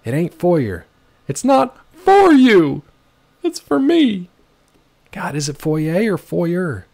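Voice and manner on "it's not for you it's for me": high, loud, slow punning on for; singsong joke on "for you" and "for me"; playful pun. Pretend. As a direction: stretch "for" playfully. Punning on foyer.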